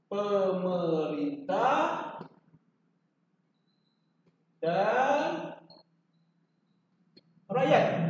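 very slow, long gaps between words; slow classroom cue; drawn-out prompt. Shown as a demonstration. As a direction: stretch it out so students fill in. Teacher drawing out answer for class.

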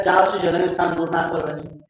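ordinary talk, nothing marked

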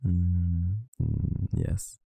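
normal talking voice

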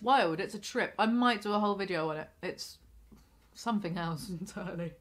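talking while chewing; speaking between bites; mouth full of pastry. Real, not acted. Seen 1: Pastry held at lips, bites between phrases; mouth likely partly full.

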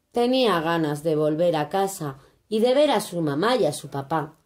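ordinary delivery, nothing marked

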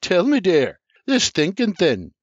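R J teasing Sarah with a grin; cheeky chirp. A narrator's reading. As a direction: needle her playfully. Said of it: "sophomoric grin and chirps".

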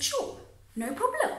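retold casual yes, high and light; easygoing agreement. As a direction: agree lightly, as if it costs nothing. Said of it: "I said".

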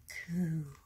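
long, gentle coo; baby ghost coo. A narrator's reading. Crowds waiting by the cradle hear it, cooing.

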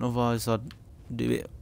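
ordinary talk

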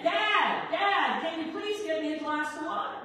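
high, loud pleading yell; imitated child's scream. Boy asks his dad for a glass of water, imitated screaming. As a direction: holler like a boy begging from bed, acted. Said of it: "the boy screams again".

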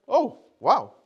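high, loud retold exclamation; surprised. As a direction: act out pleasant astonishment. Reacting to a quick phone call.

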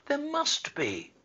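put-on indignant girl pleading; insisting cry. A narrator's reading. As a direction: refuse to believe it. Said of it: "cried Mary".

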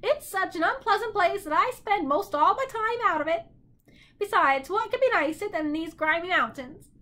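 high, emphatic bird voice; disdainful bird. A narrator's reading. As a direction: complain in a high squawk. Bird character disparaging Context.

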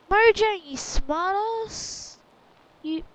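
loud, high, drawn-out playful scolding; mock outrage. Player scolding Mojang for the joke, loud mock outrage. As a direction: call them out loudly, stretching the insult.

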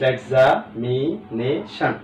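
"Examination" slowly divided into stretched syllables. Shown as a demonstration. Teacher proving that splitting is easy, syllable by syllable. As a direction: sound out "examination" part by part. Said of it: "ভাগ করা অত্যন্ত".

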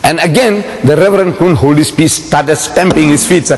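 ordinary talk

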